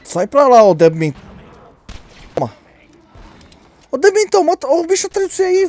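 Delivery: loud, high insulting shouts; angry yelling. Real, not acted. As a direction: yell insults at the monsters. Insulting monsters while shooting.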